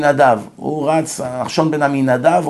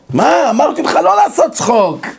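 indignant shout; 10 semitones higher than usual. Retold or imitated. Retelling a quarrel with a liar.

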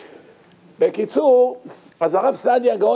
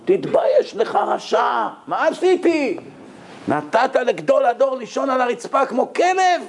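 higher, scolding cry of shame; mock outraged scolding; 7 semitones higher than usual. Guest scolds the hotel owner for the rabbi's treatment, mock outraged cry. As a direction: berate the hotel owner, high and stung. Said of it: "התחיל לצעוק, לבכות".

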